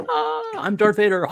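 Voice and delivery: high, playful schoolyard Vader; a kid pretending to be Vader; kid playing Darth Vader. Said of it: "playing all the characters".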